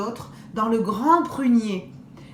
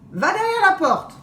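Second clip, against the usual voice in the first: wolf's order; 9 semitones higher, 5 dB louder than usual. Acted. Wolf orders the girl.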